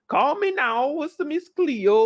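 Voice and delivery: high, slowed mock psychic hotline pitch; mock Miss Cleo ad. Recapping the show, he mimics the psychic hotline ad in a high mock voice. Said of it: "documentary on Miss Cleo".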